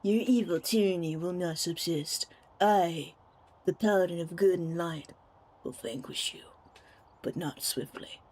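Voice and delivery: low, grand paladin speech, fading soft; a heroic paladin vowing vengeance. Retold or imitated. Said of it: "he doesn't have, like, a nasally voice".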